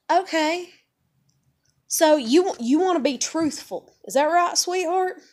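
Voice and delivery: high, loud, condescending questioning; sarcastic challenge. Real, not acted. Challenging preachers who want truth, loudly sarcastic. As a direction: sweetly taunt them, pitch and volume up.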